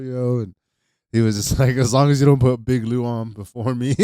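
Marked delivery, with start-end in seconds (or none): 1.8-3.9 imitating his friend. Retelling his friend's words.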